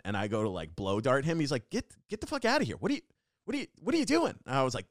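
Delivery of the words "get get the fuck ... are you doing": high, fast, flustered guard impression; agitated mock outrage, pitched way up; irate security guard. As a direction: imitate a guard ordering someone out. Security guard confronts the teller.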